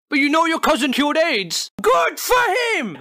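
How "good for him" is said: sarcastic shout. Real, not acted. Sarcastic outburst at parent.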